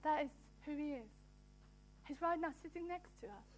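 soft, unhurried aside with long pauses; hushed reflection. Real, not acted. Preacher telling listeners he's right there waiting, hushed reflection. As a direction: let it sink in quietly.

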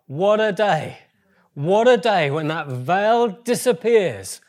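joyful exclamation. Real, not acted. Preacher exults over the future.

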